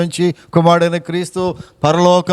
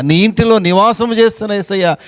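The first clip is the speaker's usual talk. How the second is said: usual speaking voice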